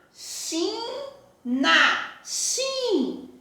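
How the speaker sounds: "Faxina" very slowly, fa-xi-na, then "xin" alone on a high pitch; syllable by syllable. Shown as a demonstration. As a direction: sound out "Faxina" in long syllables, then lift out the stressed "xin". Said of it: "eu tenho que pronunciar pra saber". ("Faxina" bem devagar, destacando "xin" em tom agudo)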